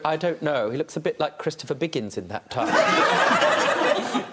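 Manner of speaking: ordinary delivery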